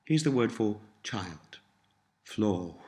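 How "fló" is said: Fló with a long, continental o. Shown as a demonstration. Tutor gives learners the word for child, Fló with long o. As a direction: draw out the o in Fló. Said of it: "Notice the long or sound".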